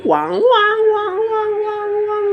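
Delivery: siren wail sung very high; ambulance siren. Retold or imitated. Teller imitating passing ambulance siren for friends, high wail. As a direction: voice the siren, wailing high and even. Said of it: "sabi daw ng ambulansya".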